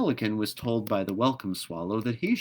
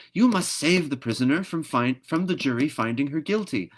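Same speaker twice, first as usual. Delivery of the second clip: higher, louder bossy bird; 7 semitones higher, 5 dB louder than usual. Acted. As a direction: order it like a fussy bird. Swallow orders magpie.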